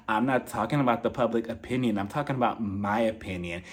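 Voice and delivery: low, soft mock voice; Crystal. Crystal corrects Erica about whose opinion, low and subdued. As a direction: answer in a dropped, quiet imitation.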